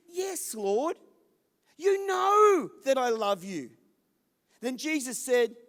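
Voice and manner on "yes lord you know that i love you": high, loud declaration, slowing at the end; emphatic reply. Acted. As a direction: proclaim it with full conviction. Peter answers emphatically.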